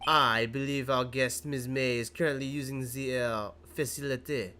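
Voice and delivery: a polite French hotel bellboy greeting; hammed-up French accent, bowing and scraping. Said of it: "I get to do a French accent".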